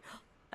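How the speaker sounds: sharp hopeful gasp; excited gasp. Retold or imitated. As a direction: gasp with sudden hope. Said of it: "somebody's like".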